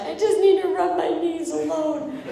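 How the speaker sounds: laughing pitiful reply to the people rushing to help; laughing pained whine. Retold or imitated. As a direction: whimper it through a laugh, slowing down. Retold reply after falling off bike.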